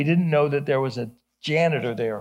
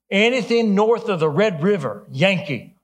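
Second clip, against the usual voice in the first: raised-voice imitated Texas drawl; 5 semitones higher, 5 dB louder than usual. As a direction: lay down the law like a Texan. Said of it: "strong Texas drawl".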